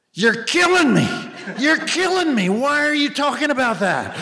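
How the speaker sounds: high, pained mock protest; Don Rickles. Rickles squealing at a fan to stop. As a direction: squeal it like a wounded comic begging him to stop. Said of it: "he's like, that was, like, his early career".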